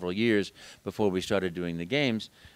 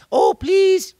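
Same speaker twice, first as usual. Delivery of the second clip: put-on begging children; 13 dB louder than usual.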